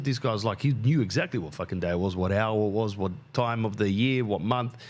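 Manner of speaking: ordinary delivery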